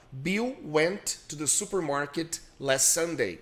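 slow, clearly spaced teacher read; slow model sentence. Shown as a demonstration. Teacher models a sentence for Isabela, slowly. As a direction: say each word slowly for the student to copy.

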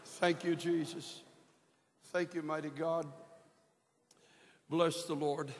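high, earnest praise to the Lord after the song; fervent praise. Real, not acted. Preacher thanking Jesus after the choir sings, high fervent praise. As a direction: lift it up in thanks, then let it sink to a hush.

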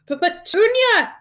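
stammered, high loud gasp. A narrator's reading. Uncle calls his wife in shock, gasping. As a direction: stutter into a drawn-out alarmed cry. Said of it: "he gasped".

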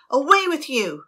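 captain waving the crow away; shooing shout. A narrator's reading. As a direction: drive the bird off.

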